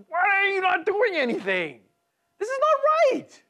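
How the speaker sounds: high, loud cry of protest at God; loud, high indignant wail; indignant outcry. Retold or imitated. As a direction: shout it as an outraged complaint. Preacher voicing his angry complaint to God.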